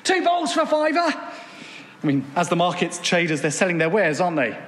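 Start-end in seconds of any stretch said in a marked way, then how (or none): 0.0-1.1 market trader call. Retold or imitated. Preacher imitating a Hemel High Street trader, shouting. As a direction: cry your wares across the high street. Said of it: "you know the sound".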